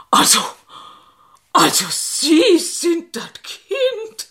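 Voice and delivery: high, slow and drawn out; slow triumphant disbelief. Acted. Old seamstress to the young woman, slow triumphant disbelief. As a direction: savour the discovery, slowly.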